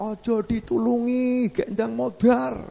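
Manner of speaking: mock crowd yell held on one high note; imitated crowd shouting. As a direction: call it out high and slow like villagers. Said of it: "tiba-tiba itu masyarakat teriak-teriak".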